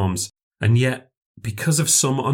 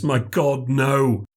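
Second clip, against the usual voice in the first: higher, appalled "no"; extraordinary emphasis; 5 semitones higher, much slower than usual. A narrator's reading. Castaway forbidding a boat, emphatic "no". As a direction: stress "My God, no" in horror. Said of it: "with extraordinary emphasis".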